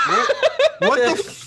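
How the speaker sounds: laughing through the words. Real, not acted. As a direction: burst out laughing mid-question. Surprised laughing at injured player.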